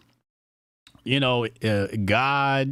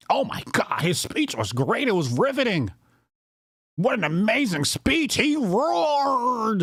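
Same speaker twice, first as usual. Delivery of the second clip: hammed-up fake awe, rising high at the end; fake gushing; 7 semitones higher than usual. Host pretending to be moved by senator's speech, fake gushing. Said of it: "and be fake".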